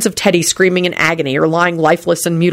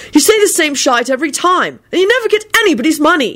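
high-pitched, exasperated griping; irritable; 10 semitones higher than usual. A narrator's reading. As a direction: gripe at him, pitch way up. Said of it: "he added irritably".

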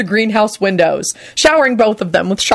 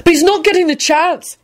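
high-pitched, defiant outburst; rebellious cry, pitch way up; defiant holler; 12 semitones higher than usual. A narrator's reading. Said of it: "he hollered out defiantly".

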